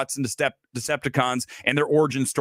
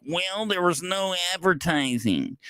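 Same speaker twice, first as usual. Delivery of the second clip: mocking whiny complainer; 5 semitones higher, 3 dB quieter, slower than usual.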